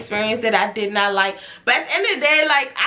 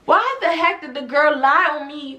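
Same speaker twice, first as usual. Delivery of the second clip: ordinary talk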